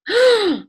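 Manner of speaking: gasp. Real, not acted. Gasp at time running out.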